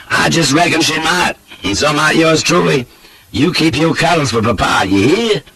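high, teasing Southern flirt; lecherous old Southern goat. A narrator's reading.

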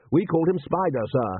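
respectful report to a superior; deferential butler. A narrator's reading. Butler reporting on dead soldier.

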